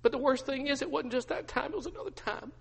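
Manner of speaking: high, hushed confession; acted anguish. Peter telling his wife of the denials, anguished. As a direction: admit it softly in a pained high voice. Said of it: "Peter just begins to weep".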